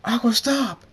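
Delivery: high, very loud urgent yell; screamed command. A narrator's reading. Thunderstorm calls to stop Aqua, screaming. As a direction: scream to halt her. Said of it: "opens his eyes and screams".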